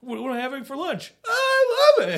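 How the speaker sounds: building from pleased to a high, loud squeal; mock delight. Shopper finding exactly what she wants every time, gushing in mock delight.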